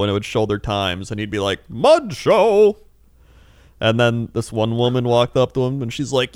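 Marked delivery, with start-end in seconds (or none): 1.7-2.8 loud, high carnival barker shout; showman call. Retold or imitated. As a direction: bark it like a fair announcer. Performer announcing show.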